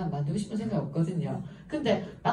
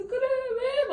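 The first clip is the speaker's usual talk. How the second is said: high tearful wail, imitated; hammed-up weepy question; mock crying along. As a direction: cry along in exaggerated sympathy. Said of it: "같이 막 울면서".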